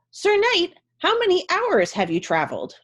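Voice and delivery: lightly voiced princess, high on "Sir Knight"; a hint of a high little princess.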